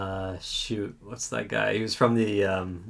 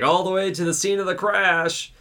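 high worried question; nervous passenger; 8 semitones higher than usual. Retold or imitated. Scared passenger asking the drunk about the engine, high and anxious. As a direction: ask it fretfully, pitched up. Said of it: "And he's like".

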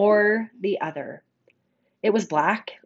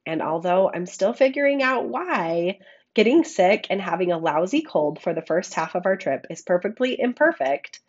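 ordinary talk

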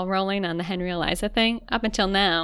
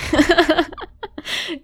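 high, loud peal of laughter; real helpless high laugh; 9 semitones higher, 7 dB louder than usual. Real, not acted. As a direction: burst out laughing. Laughing after the apology.

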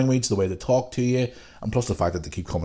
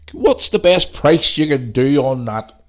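put-on slow, louder older customer haggling; 4 semitones higher, 5 dB louder, slower than usual. Older shopper haggling with the shop assistant, slow and louder. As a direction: drag it out like a pensioner bargaining. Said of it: "I'm gonna put a voice on here".

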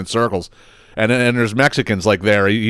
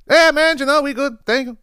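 much higher, squeezed voice for the customer; put-on customer voice. Mimicking a customer answering his question in a high voice. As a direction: voice the guy at the counter answering, way up high. Voicing the Mexican customers reply.

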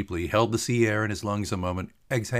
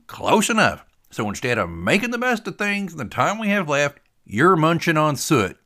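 exasperated buddy, pitched up; needling Tater; 8 semitones higher, 6 dB louder than usual. Acted. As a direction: accuse him with relish.

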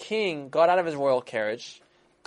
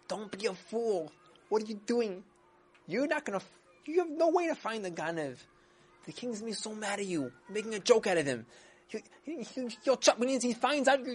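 strange whispering voice; 7 semitones higher than usual. Acted. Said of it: "strange voice whispering to him".

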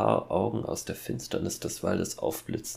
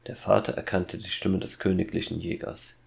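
usual speaking voice

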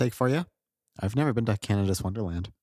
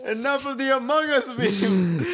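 high mock exasperation; 14 semitones higher, slower than usual. Exasperated at meme.

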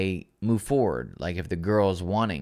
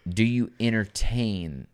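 dramatic slow; 2 semitones lower than usual. Acted.

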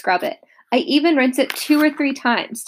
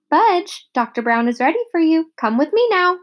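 nurse voice; 8 semitones higher, 3 dB louder than usual. A narrator's reading. Nurse calls the little boy.

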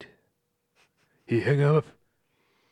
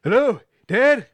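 higher, louder hopeful hello to Dad; loud phone greeting; 7 semitones higher, 7 dB louder than usual. Acted. Answering father's call.

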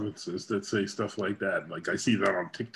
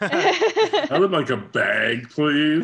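high, loud, drawn-out mock request; joking, laughing request; 6 semitones higher, 6 dB louder, much slower than usual. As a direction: play it up slowly through laughter. Joking along with the bag story.

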